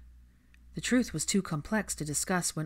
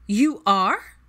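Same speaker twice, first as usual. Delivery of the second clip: shocked question; 4 semitones higher, 9 dB louder than usual. Acted.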